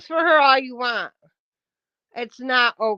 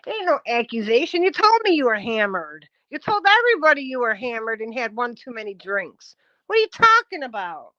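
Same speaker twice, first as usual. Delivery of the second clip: ordinary talk, nothing marked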